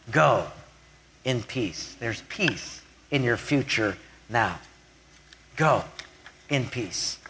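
soft, low, tender send-off; gentle blessing. Acted. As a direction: release her softly, with pauses between phrases. Voicing Jesus sending the forgiven woman off.

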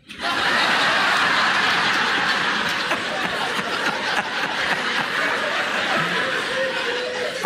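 long silent wheezing laugh; breathless laugh. Real, not acted. Preacher laughing at his own joke, breathless wheeze. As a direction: crack up at your own punchline without a sound.

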